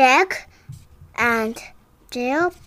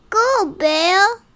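cheering; 7 semitones higher, 8 dB louder than usual. Acted. Cheering in story.

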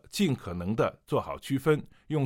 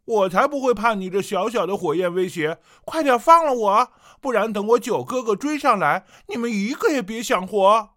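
much higher and louder, arrogant and shrill-free bluster; Princess Honglian, haughty threat; 11 semitones higher, 10 dB louder than usual. Shown as a demonstration. Captive princess threatening her captors, haughty and loud. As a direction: threaten like a spoiled proud princess. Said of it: "高傲的下巴…威胁道".

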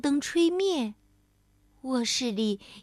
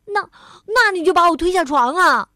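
loud, higher accusing retort; outraged; 5 semitones higher, 9 dB louder, faster than usual. A narrator's reading. Bengbeng accuses friend of pushing, outraged. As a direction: throw it back, outraged. Acted quarrel after the fall.

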